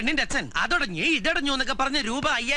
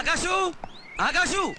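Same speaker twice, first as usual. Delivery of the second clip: higher-pitched searching call; 6 semitones higher, much slower than usual. Acted. Searching for missing Akashu, calling out. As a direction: call the name out into the distance.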